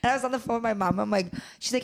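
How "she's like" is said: ordinary talk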